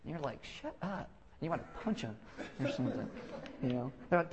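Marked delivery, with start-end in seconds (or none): 0.6-1.1 soft, higher muttered retort; hushed mock irritation; mock exasperation. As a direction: mutter it, fed up with the optimist. Imitates exasperated reply.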